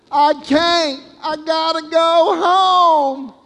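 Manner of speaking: put-on high, slow, urgent boy's voice; a small boy pleading that he must go home; little boy's plea. Little boy refusing the ride, high slow plea. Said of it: "it's my favorite line".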